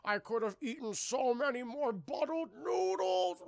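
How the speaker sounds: high, slow, hammed-up wail; drowning boy's lament. Pete lamenting as the bus floods, mock wail. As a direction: draw out a mock dying regret.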